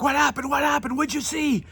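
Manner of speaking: very high, frantic mock questioning; imitated panic. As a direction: pester like a panicked buddy. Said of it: "he's just panicked".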